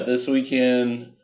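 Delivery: ordinary talk, nothing marked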